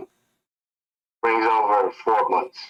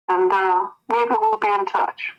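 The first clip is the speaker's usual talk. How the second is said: sweet coy voice; 9 semitones higher, slower than usual. Acted. Woman voiced, higher.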